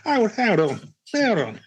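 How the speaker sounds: high, pouncing detective "hold on". Pretend. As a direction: stop everyone like a TV sleuth. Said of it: "Aussie Colombo".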